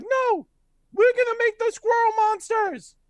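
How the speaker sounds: put-on loud, very high squealing kid voice; defiant little kids. Kids refuse the centaur, high defiant squeal. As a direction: shout back like a bratty small child. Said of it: "And they're like".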